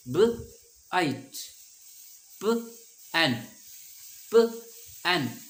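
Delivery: loud, high "प" then "एन" split apart; teacher pulls "प" and "एन" apart; sounding out short vowels. Shown as a demonstration. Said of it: "short बन रहा है". ("प" और "एन" अलग करके ऊँचा बोलें)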